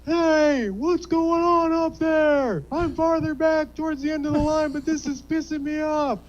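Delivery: Bessie Bones. Acted. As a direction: play an irate customer shouting from the back of the line. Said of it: "My name is Bessie Bones".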